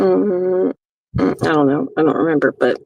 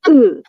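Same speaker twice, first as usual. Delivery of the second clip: louder exaggerated ugh; a put-on disgusted groan for the bit; hammed-up groan; mock groan; 7 dB louder than usual. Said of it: "this little thing that I keep doing".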